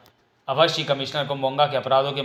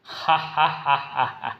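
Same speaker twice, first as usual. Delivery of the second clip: villain laugh; much slower than usual. Acted. Villain mocks Raka.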